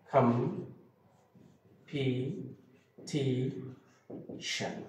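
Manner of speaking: কম্পিটিশন said very slowly with long gaps between syllables; drawn-out, separated beats on কম্পিটিশন; broken into syllables. Shown as a demonstration. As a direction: spell out কম্পিটিশন by ear, one syllable at a time. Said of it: "ভেঙে ভেঙে একটা শব্দকে বলা".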